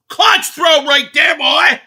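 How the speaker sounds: high, loud hype yell; excited shout. Real, not acted. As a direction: holler it out in triumph. Third, shouted repeat.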